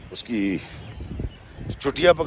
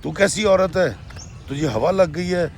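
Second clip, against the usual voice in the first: rude scolding; 5 semitones higher than usual. Retold or imitated. Mother-in-law rudely scolds the young wife for complaining. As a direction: berate the daughter-in-law with contempt. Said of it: "بڑی بدتمیزی سے اس نے کہا".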